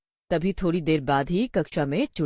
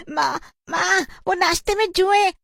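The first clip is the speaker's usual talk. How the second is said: put-on loud, high, panicky girl voice; distressed schoolgirl; 13 semitones higher, 4 dB louder than usual. A girl tells mother of lice at breakfast, panicked. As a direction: cry it out in horror.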